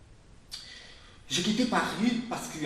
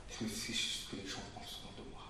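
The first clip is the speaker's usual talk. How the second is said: whispered confession; 7 semitones lower, 11 dB quieter than usual. Acted. Intimate confession to audience.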